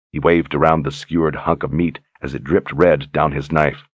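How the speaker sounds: plain reading voice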